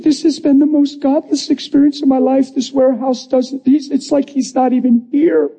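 high-pitched crying voice; imitated tearful outpouring. Head of HR confessing to new employee, tearful. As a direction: pour it out tearfully, imitating her. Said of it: "she starts bawling".

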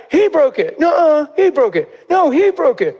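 high-pitched mock child blame-shifting; indignant little-kid denials, hammed up; bickering kids. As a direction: play squabbling children pointing the finger. Children blame each other, acted.

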